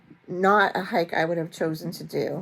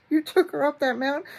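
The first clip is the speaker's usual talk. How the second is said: higher, louder mock disbelief; shocked brother-in-law; 8 semitones higher, 4 dB louder than usual. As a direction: imitate a shocked drugged patient. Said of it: "he was like".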